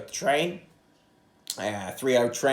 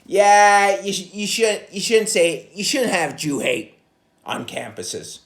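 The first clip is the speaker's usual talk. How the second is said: loud, higher, hectoring the senators; mock lecture to senators; 7 semitones higher, 6 dB louder, slower than usual. Comic lecturing the senators at a hearing, loud and preachy. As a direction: scold Congress like a know-it-all. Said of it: "I could go in front of those, uh, congressional hearings".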